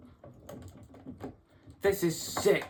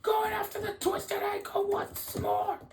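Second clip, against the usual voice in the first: frantic outraged yelling. Acted. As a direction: yell in horror as the attack goes on. Commentator horrified by renewed attack.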